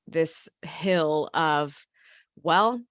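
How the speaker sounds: usual speaking voice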